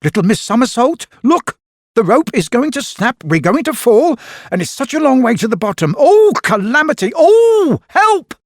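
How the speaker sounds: high, loud hammed-up panic, rushing; storybook panic on a snapping tightrope. A narrator's reading. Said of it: "he cried out in panic".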